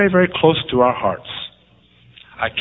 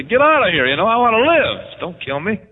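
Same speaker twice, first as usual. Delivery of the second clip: mock protest; 9 semitones higher, 6 dB louder than usual. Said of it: "I say".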